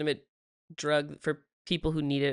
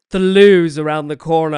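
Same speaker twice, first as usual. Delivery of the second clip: loud mock British voice, laughing at her; loud, mocking British accent; mock British waiter, loud and amused; 14 dB louder than usual. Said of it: "laughed in my face".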